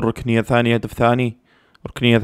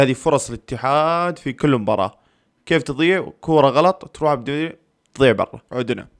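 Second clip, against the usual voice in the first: normal talking voice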